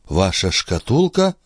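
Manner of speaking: plain reading voice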